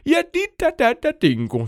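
high, loud little-poop chorus voice; squeaky little creatures. Acted. The three little poops answer Nora in chorus, squeaky. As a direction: chant it like tiny mischievous creatures. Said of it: "sagde de tre små lorte i kor".

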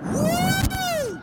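very high whoop; excited whoop. Acted. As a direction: whoop while flying. Flying off.

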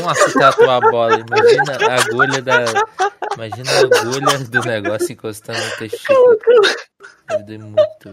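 laughing, loud and high; words through real laughter. Real, not acted. Laughing at the tattoo joke.

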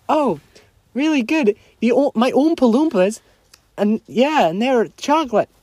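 a hint of a whimsical chocolatier; light mock Wonka on the phone; Willy Wonka. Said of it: "hello, Willy".

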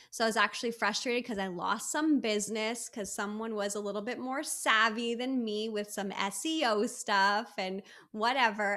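normal talking voice

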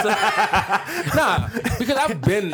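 laughing while talking; amused laugh breaking in. Real, not acted. Laughs mid-sentence.

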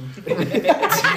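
a high, loud whooping laugh; loud burst of laughter. Real, not acted. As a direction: crack up hard.

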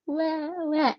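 high mock newborn wah; imitated baby cry. Mother imitating her newborn's first cry, high wail.

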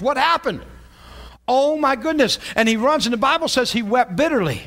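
rising sermon delivery; preaching. Acted. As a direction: drive the story home to the church. Preaching rises in pitch.

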